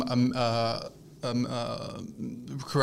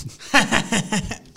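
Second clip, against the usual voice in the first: Green Goblin laugh; 8 semitones higher, 4 dB louder than usual. Acted. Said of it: "Trying to go Willem Dafoe from Green Goblin".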